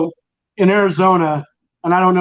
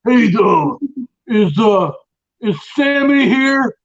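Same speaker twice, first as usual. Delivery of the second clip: higher-pitched mock New York mobster; 5 semitones higher than usual. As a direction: do a hammed-up New York Italian wiseguy. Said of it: "it's a stereotypical, uh, accent".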